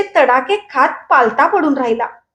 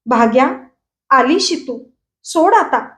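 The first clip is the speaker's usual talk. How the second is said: ordinary delivery, nothing marked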